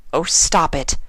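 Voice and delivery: anxious scholar pony pleading; Twilight, worried. A narrator's reading. Twilight voiced in story.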